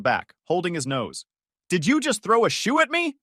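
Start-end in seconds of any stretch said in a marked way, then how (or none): 1.7-3.2 high, shocked protest after the shoe hits; stunned yelp at the neighbor; indignant disbelief. Acted. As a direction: squeal it in disbelief. Dorian hit by slipper.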